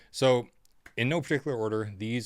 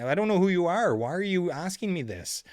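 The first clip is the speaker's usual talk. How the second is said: louder, higher, fed-up outburst; exasperated; 8 semitones higher, 6 dB louder than usual. Retold or imitated. Musician snapping at the pushy lady, exasperated outburst. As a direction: throw up your hands at her. Refusing lady's song requests.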